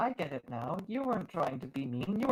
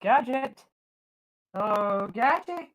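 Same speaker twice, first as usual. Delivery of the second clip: calling out; 4 semitones higher, 5 dB louder, slower than usual. Acted. Calling out the captain's name.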